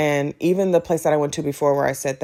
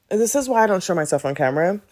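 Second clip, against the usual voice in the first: ordinary delivery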